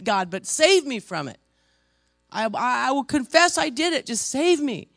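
high, shouted cry to God; impassioned reenacted confession, shouted; desperate plea. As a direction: beg loudly to be rescued, at full force.

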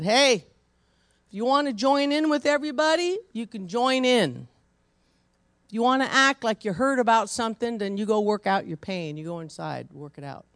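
loud, fast scolding mother; firm mama lecture. Retold or imitated. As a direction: lay down the law to the boy.

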